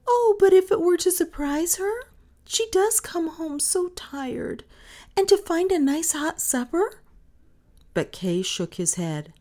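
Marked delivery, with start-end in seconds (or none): 0.1-7.0 high, louder wistful pleading; coaxing little girl. Acted. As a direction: coax in a high child's voice, questioning at the end. Little girl coaxes Kay.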